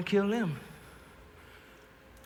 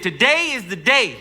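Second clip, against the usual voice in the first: higher, louder declaration; bold announcement; 5 semitones higher, 6 dB louder than usual. Acted. Soldier tells his bunkmate the day has come, bold announcement. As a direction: announce it boldly, pitch and volume raised.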